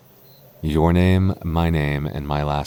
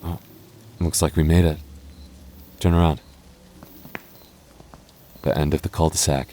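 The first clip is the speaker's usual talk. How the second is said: usual speaking voice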